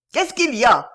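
shouted. A narrator's reading. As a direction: call out brusquely to whoever is at the door. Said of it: "cria de l'intérieur la voix du commissaire". (crié d'une voix forte)

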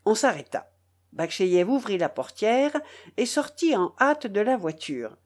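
usual speaking voice

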